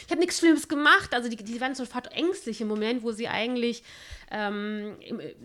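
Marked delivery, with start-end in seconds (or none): 0.1-1.1 high, loud and frantic pleading; panicked protest. Retold or imitated. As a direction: squeal out your innocence in panic. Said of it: "der flippt dann ja auch". (panisch und schrill beteuernd)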